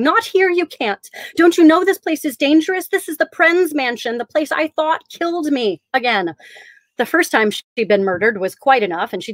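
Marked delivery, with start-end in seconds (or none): 0.0-6.3 high, urgent voice cracking with fear; voice breaking. Acted. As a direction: plead with the voice breaking. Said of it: "her voice breaking".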